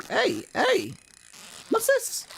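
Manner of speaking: high-pitched mock excitement; squeaky high voice. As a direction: pop up into a cartoon squeak. Excited squeak at fishing catch.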